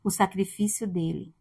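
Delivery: quiet earnest preaching; soft sermon. Acted. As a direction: bring the congregation close, gently.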